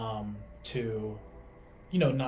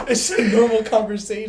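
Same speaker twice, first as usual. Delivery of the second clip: much higher and louder, cracking up; laughing; 11 semitones higher, 18 dB louder than usual. Real, not acted. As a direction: say it through real laughter. Laughing after failed sneeze.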